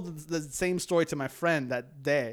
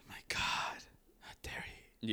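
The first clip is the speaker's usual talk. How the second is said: hushed mock outrage; play-acted horrified whisper; scandalized whisper; 5 semitones lower, 11 dB quieter than usual. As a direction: whisper it like a shocked gossip. Joins in as scandalized onlooker.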